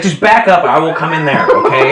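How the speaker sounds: high, loud order with a laugh; ant warning. Acted. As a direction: shove the order at him. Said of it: "He's like".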